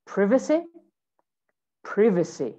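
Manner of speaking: British "privacy", PRIV-a-see; short i in "privacy"; British pronunciation. Shown as a demonstration. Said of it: "In British English".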